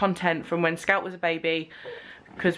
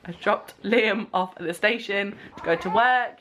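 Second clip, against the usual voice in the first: ordinary delivery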